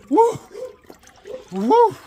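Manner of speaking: high loud woo from the cold; whooping at the icy air; loud high shivering woos. Real, not acted. Getting out of cold water.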